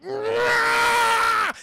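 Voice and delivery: loud high battle roar; imitated berserker war cry, very loud and high. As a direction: let rip a rage yell as he snaps.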